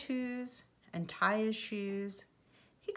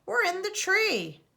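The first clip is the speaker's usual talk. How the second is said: much higher, louder shout from the tree; shouting back; 11 semitones higher, 6 dB louder than usual. A narrator's reading. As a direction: call down cheerfully from up high. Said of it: "Fox shouted back".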